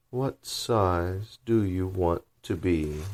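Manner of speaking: very slow, drawn-out creature voice; caterpillar voice. Acted. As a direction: drawl it like a lazy, stern caterpillar. Caterpillar asking.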